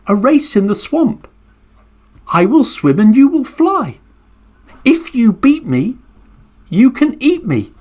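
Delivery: high, bright challenge from a tiny turtle; little turtle voice. Acted. Turtle daring the Garuda bird to race, high and cheeky. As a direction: dare the big bird sweetly.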